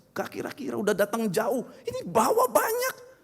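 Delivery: high-pitched offended complaining; indignant rant. Acted. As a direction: play the insulted VIP ranting. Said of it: "dia kesel".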